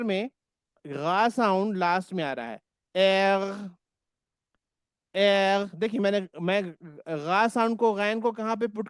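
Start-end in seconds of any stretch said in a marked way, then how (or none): 3.0-5.8 एर ending in a rasp from the epiglottis; throaty German R. Shown as a demonstration. Teacher drilling students on the German R, throaty. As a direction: push एर back into the throat. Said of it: "बिल्कुल epiglottis से".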